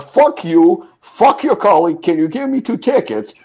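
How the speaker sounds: high, heated outburst; angry retort. Retold or imitated. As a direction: re-enact losing your temper at a clerk. Acted angry retort to rude clerk.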